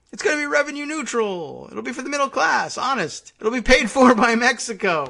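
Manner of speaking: high mocking sing-song voice; a sarcastic host mimicking the president's fake plan; mocking Trump. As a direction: parody the promises, all smug. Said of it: "mocking Trump's fake plan".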